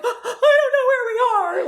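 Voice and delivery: gasp, then a high, loud, tearful wail; half-laughing, replaying her own breakdown; panicked crying. Telling the teachers she is lost, sobbing in panic. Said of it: "I'm just freaking out".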